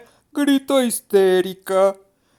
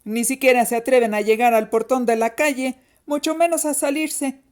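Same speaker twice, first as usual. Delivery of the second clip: put-on quick, scoffing older-sister voice; teasing, dismissive girl's voice; taunting dare; 4 semitones higher, faster than usual. A narrator's reading. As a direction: dare them, sure they will back down. Big sister dares the children.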